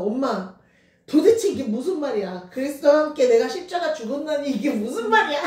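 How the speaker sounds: retold crying plea. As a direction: replay the sobbing question to mom, without real tears. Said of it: "엉엉 울면서". (엄마에게 울며 묻던 걸 흉내, 크고 높게)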